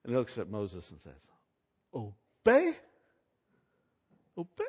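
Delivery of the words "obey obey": mocking, high, louder Obey? with a scornful rise; sarcastic. Pharaoh scoffing at Moses, sarcastic Obey?. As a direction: sneer the word Obey? back like a haughty Pharaoh. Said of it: "Just real sarcastically".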